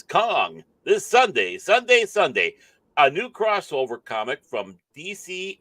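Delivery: lifted, punchy presenter reading; emphatic, hyped article read; article read-aloud. Real, not acted. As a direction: read it out like an entertainment news item.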